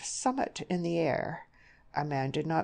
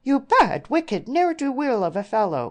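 loud, high-pitched rush of fury; furious outburst; 9 semitones higher, 9 dB louder than usual. A narrator's reading. Outraged mother scolding her daughter's suitor, furious. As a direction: lash out at the scoundrel. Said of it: "in a rush of fury".

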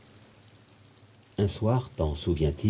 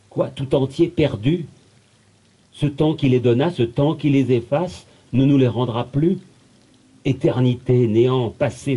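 raised pitch, indignant poetic declamation; impassioned questioning; 6 semitones higher than usual. Acted. Poet demanding answers from time and eternity, impassioned. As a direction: build the protest, pitched high on each question.

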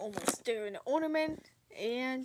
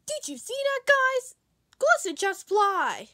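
much higher, loud excited call; amazed shout; 6 semitones higher, 9 dB louder than usual. Acted. Reindeer telling the others Glisten flew, amazed shout.

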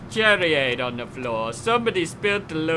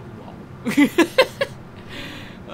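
breathy laugh; 11 semitones higher than usual. Real, not acted.

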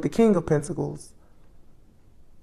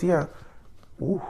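usual speaking voice

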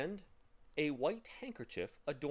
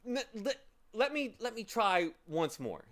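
stammering start, raised pitch; effortful explaining; 11 semitones higher, 6 dB louder than usual. A narrator's reading. Milo trying to explain to the bird, flustered and stammering. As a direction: stumble in, then try to explain. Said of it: "he said in an effort to explain".

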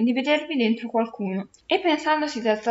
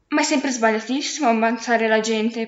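plain reading voice

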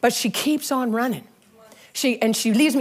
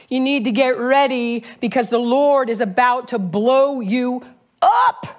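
prophetic preaching; 4 semitones higher, slower than usual. Acted. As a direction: speak it as a word from the Lord over him. Prophetic word over the church.

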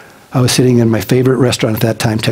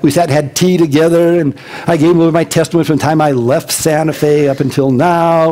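usual speaking voice

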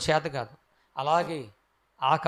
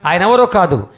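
louder, higher preaching at full force; fervent preaching; 5 semitones higher, 11 dB louder than usual. Acted. Preacher proclaiming Jesus' name to the crowd, loud and fervent. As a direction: proclaim it to the congregation with fire.

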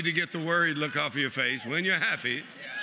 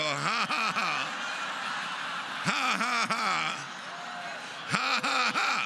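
faith laugh; 4 semitones higher, slower than usual. Shown as a demonstration. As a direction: laugh at the impossible, building up. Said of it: "and do a faith laugh right now".

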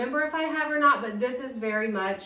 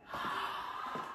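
quick breathy gasp of delight; soft gasp; 13 dB quieter than usual. Real, not acted. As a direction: gasp as if falling in love with it. Delighted by the outfit.

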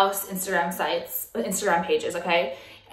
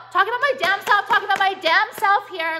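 heated rant; 9 semitones higher than usual. Real, not acted. Ranting about critics.

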